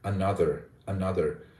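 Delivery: another with the stress on NO; stressed middle syllable. Shown as a demonstration. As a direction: lean on the second syllable of another. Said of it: "use syllable stress effectively".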